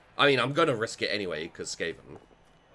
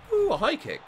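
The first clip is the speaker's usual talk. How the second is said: surprised ooh; 5 dB louder than usual. Real, not acted. Reacting to a game event.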